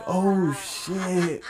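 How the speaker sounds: startled curse; mock alarm. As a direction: react like you just got caught. Caught in a lie, panic.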